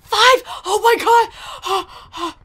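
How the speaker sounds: loud, high burst of excitement; breathless, overjoyed yell; ecstatic shock. Real, not acted. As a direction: shout it, gasping in amazement. Gets the fifth kill.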